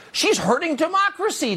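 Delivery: high-pitched mimicked outcry; mock the critics in a very high voice; mock yell. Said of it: "they yell".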